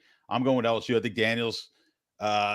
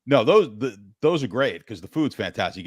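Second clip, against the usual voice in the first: ordinary talk, nothing marked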